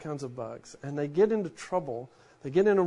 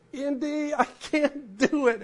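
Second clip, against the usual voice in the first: raised-pitch mock plea from the heroine; pleading woman; 8 semitones higher, slower than usual. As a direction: play the scared sidekick begging, higher. Woman pleading in movie retelling.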